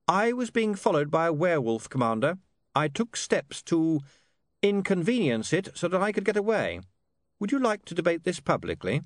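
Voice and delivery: put-on high-pitched, deliberate young man; haughty witness. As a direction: justify yourself loftily to the commander.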